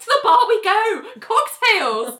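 high, gleeful rallying cry; rally everyone out to celebrate; celebratory call. Retold or imitated. Richard leading everyone to the bar, celebratory call.

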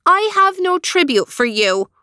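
brave. Acted. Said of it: "said Eileen bravely".

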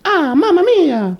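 put-on high, slow, hammy Italian accent; squeaky over-the-top Mario Italian accent; fake Italian accent. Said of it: "His fake Italian voice".

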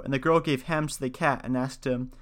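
ordinary delivery, nothing marked

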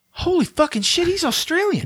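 high, astonished exclamation; squeaky disbelief at a revelation; shocked realization. Retold or imitated. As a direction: gasp it out like a stunning discovery. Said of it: "as an adult and going".

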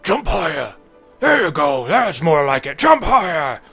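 loud, high dopey yell; dopey cartoon bellow. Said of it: "you hear a deep voice kind of yell out".

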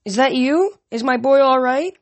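mother calling out. A narrator's reading. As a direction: call anxiously to your son. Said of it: "Mika Stellan called".

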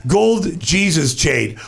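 normal talking voice

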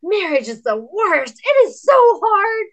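loud, high, exasperated declaration; emphatic outburst. Retold or imitated. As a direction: belt it out as a blunt confession. Blunt confession retold to discouraged woman.

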